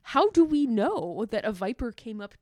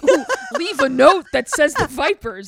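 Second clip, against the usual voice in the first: louder, giggling scheme; mock scheming gangster; 5 semitones higher, 10 dB louder than usual. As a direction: play a sly rival gang member. Imitating rival gang plotting.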